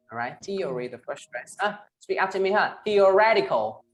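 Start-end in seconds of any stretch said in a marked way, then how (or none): none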